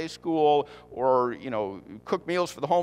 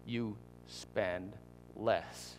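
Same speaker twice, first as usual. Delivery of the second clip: hushed, low, word by word; confiding a secret; 6 semitones lower, 10 dB quieter, much slower than usual. Shown as a demonstration. Preacher telling the secret of saving, hushed and slow. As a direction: drop low and space out each word. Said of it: "I'll tell you the secret of how to do that".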